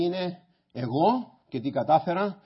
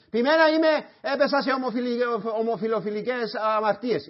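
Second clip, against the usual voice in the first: mock sinner's plea; 9 semitones higher, 5 dB louder than usual. Imagined sinner's confession.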